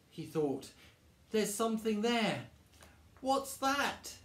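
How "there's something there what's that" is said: high-pitched, apprehensive wondering; nervous alarm. Acted. Eddie sees something in the woods, nervous. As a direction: peer into the dark, anxious and high. Character frightened in dark woods.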